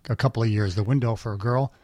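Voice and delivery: normal talking voice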